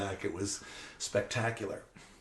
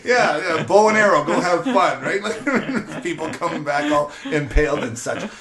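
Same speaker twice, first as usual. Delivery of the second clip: high, loud laughing through the words; giggling while listing deadly toys; 8 semitones higher, 14 dB louder than usual. Real, not acted. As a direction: laugh it out, delighted by the absurdity. Laughing about dangerous old toys.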